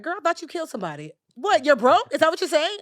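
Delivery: high, loud, quick disbelief; incredulous friend. Retold or imitated. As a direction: Linda hearing she's only broke, relieved disbelief. Friend reacts to confession.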